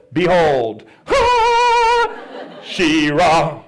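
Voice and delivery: loud, grand proclamation; the shadow revealed. Acted. The shadow revealing itself, loud mock-heroic. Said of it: "all of a sudden the shadow goes".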